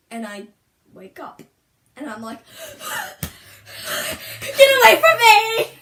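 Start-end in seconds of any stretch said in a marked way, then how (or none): 2.5-4.4 high, sharp gasping breaths; frightened gasp. Retold or imitated. Dreamer waking from a clown nightmare, frightened gasping. 4.4-5.7 very loud, high shriek; panicked scream. Retold or imitated. Girl re-enacting waking from a nightmare, panicked screaming.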